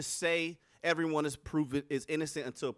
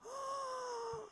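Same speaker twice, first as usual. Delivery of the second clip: long, high, soft intake of breath; drawn-out scandalized gasp; mock shocked gasp; 12 dB quieter than usual. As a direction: gasp at your own wild idea. Shocked at own idea.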